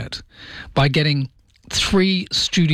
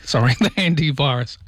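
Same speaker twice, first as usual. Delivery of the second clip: normal talking voice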